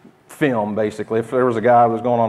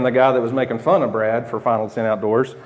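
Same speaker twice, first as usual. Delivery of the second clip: ordinary talk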